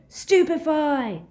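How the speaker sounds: high, drawn-out yell; yelled spell. A narrator's reading. Harry aiming at Snape's back, yelling. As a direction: cry the spell out long and loud. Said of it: "and yelled".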